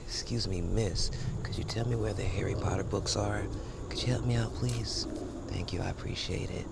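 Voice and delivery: polite hushed request; library quiet. Pretend. Asking a librarian for Harry Potter books in a hushed voice. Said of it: "library mode".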